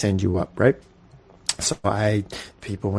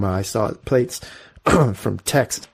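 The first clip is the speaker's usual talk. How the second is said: ordinary talk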